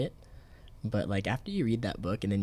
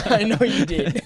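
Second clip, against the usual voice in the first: high, loud laughing tease; 10 semitones higher, 7 dB louder than usual. Real, not acted. Friend teases co-host who checked for an audiobook, laughing. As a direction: say it through laughter, higher and louder.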